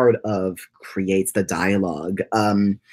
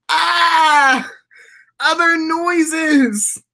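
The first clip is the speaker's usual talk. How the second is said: squeaky yelp; 4 dB louder, much slower than usual. Pretend. Silly noise after squeaky bit.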